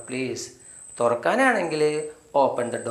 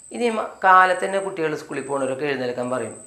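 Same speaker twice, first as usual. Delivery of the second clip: ordinary delivery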